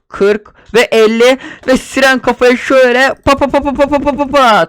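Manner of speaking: playful chanted pa pa pa; silly pa-pa sounds. Pretend. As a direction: burst into goofy sound effects. Silly counting and sound effects.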